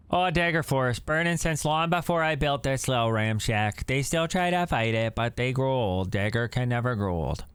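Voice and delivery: put-on light Canadian accent, folksy; friendly Canadian old-timer. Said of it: "I made him Canadian".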